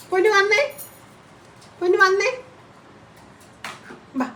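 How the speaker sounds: high-pitched cooing summons; coaxing pet call. Real, not acted. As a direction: coax the bird over in a high little voice. Calling the pet to come over.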